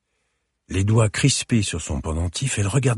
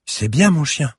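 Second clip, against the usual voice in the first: put-on higher, louder child's praise; delighted little girl's voice, pitched up; child praising dog; 10 semitones higher, 6 dB louder than usual. A narrator's reading. Girl praises her dog for fetching, delighted.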